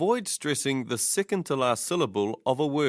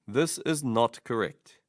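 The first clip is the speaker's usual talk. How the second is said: ordinary talk, nothing marked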